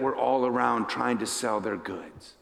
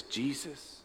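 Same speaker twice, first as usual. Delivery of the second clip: soft, halting plea; tearful; 5 semitones lower, 4 dB quieter, slower than usual. Acted. Kneeling blind Bartimaeus answering Jesus, hushed tearful plea. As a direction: say it quietly and slowly, pausing before the last word. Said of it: "with tears rolling down his face".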